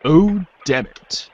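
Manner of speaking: ordinary delivery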